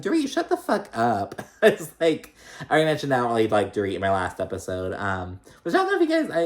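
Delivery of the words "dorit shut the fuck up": high, sharp mock retort; exasperated snap. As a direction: tell Dorit off with a laugh in the voice. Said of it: "I was like".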